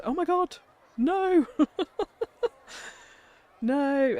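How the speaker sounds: high loud cries of dismay, laughing; shocked shout. Real, not acted. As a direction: shriek in laughing horror. Building breaks in game.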